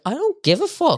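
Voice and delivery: high, sharp mock defiance; defiant Kate. Kate standing up to the addict, mock defiance. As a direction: fling it back fearlessly, acted.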